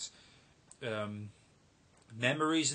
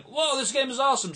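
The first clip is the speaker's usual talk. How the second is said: excited; 11 semitones higher, 5 dB louder, faster than usual. Retold or imitated. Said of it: "but I was like".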